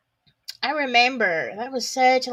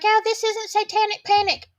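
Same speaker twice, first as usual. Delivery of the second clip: mocking everybody; 11 semitones higher than usual. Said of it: "Everybody's like".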